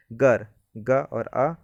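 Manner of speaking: very slow, breaking गर into ग and अ; slow syllable demo. Shown as a demonstration. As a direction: sound out ग और अ piece by piece. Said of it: "और र इधर लिखा होगा आगे".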